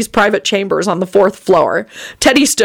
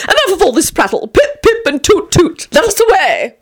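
flamboyant dandy; 10 semitones higher than usual. A narrator's reading. Tony's flamboyant exit call.

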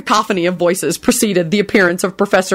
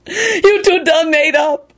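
high, slow, tearful thanks; blubbering; 9 semitones higher, slower than usual. Acted. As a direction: weep with relief.

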